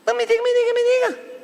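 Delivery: high-pitched, repeated insistent demanding; high, indignant badgering. Real, not acted. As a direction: press for an answer, pitched right up. Demanding an answer in mock indignation.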